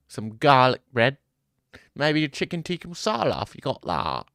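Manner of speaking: hammed-up UK chav accent ordering food; mock British accent. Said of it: "Y'all got the worst accent. U K, bro".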